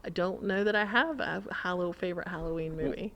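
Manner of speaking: usual speaking voice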